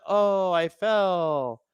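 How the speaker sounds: slow, drawn-out fake falling voice; hammed-up diver narrating his own fall; mocking the diver. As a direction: ham up a slow-motion dive. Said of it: "He was just like".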